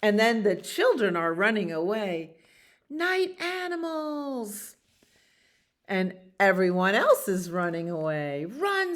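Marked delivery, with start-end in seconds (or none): 2.9-4.8 high, long-drawn wail; children's alarmed cry. Acted. Children running from the animals, high drawn-out cry. Children run away calling out. 8.4-8.9 high, urgent little cry; fleeing wolf cub. Acted. Wolf cub urges everyone away, high urgent cry. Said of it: "said the wolf cub".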